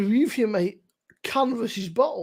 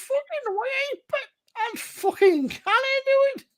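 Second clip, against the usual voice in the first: high, hammed-up Geordie impression; Geordie accent; 14 semitones higher than usual. Teasing Joe by mimicking his Geordie accent. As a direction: squeal it like a cartoon Geordie lad. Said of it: "I'm like".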